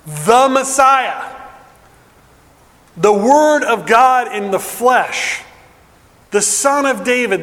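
building sermon climax. Acted. Preacher piling up Jesus' titles for the congregation, building climax. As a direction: climb with each title of Jesus.

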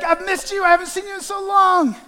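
high, loud missed-you; eager reunion. Pretend. Pastor acting a friend greeting after long absence. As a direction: gush over a long absence.

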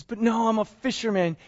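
usual speaking voice

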